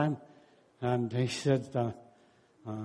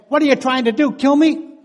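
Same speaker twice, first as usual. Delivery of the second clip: much higher and louder panicked yell; hammed-up shout at the driver; alarmed husband; 10 semitones higher, 10 dB louder than usual. Husband cries out at wife driving, mock panic.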